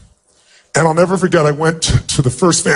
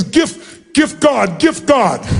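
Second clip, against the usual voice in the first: lightly voiced mother in halting English, high; the grateful mother searching for English words, pitched up; broken English; 8 semitones higher, much slower than usual. The mother thanking him in broken English, high and halting. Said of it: "then she finally says".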